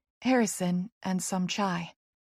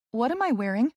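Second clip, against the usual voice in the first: 1 first puzzled ask; 3 semitones higher than usual. A narrator's reading. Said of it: "I say again".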